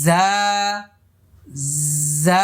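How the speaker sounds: জা with teeth together, not too hard; gentle buzzing জা demo; Arabic zay. Shown as a demonstration. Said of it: "আমার সাথে সাথে বলুন".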